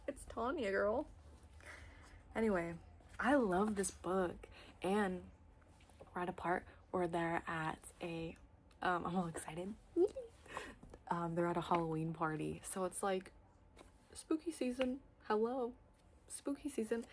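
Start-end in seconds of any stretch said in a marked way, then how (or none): 0.1-0.7 Tanya with an ah vowel, said firmly; correct pronunciation Tanya. Shown as a demonstration. Correcting the audiobook narrator, proper Tanya. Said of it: "Why are you saying it like that?". 14.1-17.1 higher, bouncy spooky season; excited sing-song. Real, not acted. Hyping up fall reads, giddy excitement.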